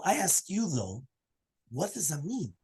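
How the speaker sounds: ordinary delivery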